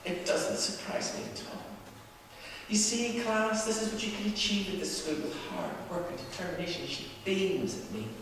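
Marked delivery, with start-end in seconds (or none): 0.0-6.9 lightly voiced teacher. Teacher praising to class.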